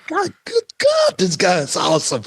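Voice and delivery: high, thrilled outburst of awe; amazed exclamation. Retold or imitated. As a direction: gasp it out in wonder. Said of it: "And I was like".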